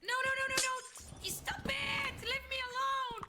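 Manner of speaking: high frantic pleading; panicked. Real, not acted. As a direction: beg to be left alone. Panicking in game.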